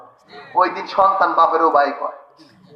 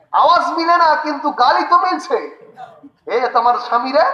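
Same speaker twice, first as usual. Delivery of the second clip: surprised realisation; 8 semitones higher than usual. Retold or imitated. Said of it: "স্ত্রী ঘরে দিয়ে কয়".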